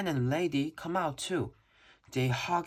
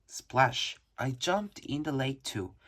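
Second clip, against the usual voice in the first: plain reading voice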